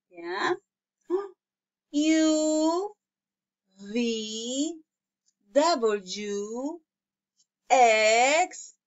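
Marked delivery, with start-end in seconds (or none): none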